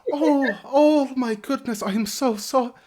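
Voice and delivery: high, loud flustered apologies; high flustered landlady; flustered innkeeper. Acted. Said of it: "she quickly goes".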